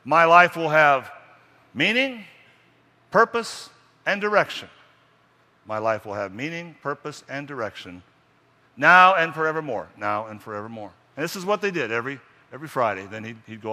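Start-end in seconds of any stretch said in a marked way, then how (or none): 0.0-4.7 slow, high and loud proclamation; booming creed, word by word; loud emphatic recital. Shown as a demonstration. 8.8-9.9 louder, ringing proclamation; booming final vow; loud emphatic close. Shown as a demonstration. Loud creed ending.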